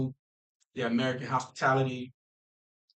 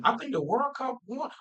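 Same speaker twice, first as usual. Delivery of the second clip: lightly higher-pitched quoted asker; voicing online askers; 8 semitones higher than usual. People asking about the World Cup, lightly mimicked askers. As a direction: give the askers a higher, questioning voice.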